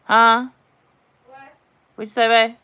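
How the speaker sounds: loud call to someone in another room. Real, not acted. Woman calling out to her partner in another room. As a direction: shout it across the house.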